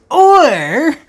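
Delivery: high, loud teasing lead-in; mock grand build-up. Host teasing viewers about his song, loud mock build-up. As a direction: tease the big reveal.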